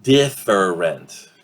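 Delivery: "Different" drawn out in three syllables; slow DIF-er-ent, every vowel of "Different" sounded; full pronunciation. Shown as a demonstration. Teacher asks how it will reduce, "Different" slowly in full. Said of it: "three syllables".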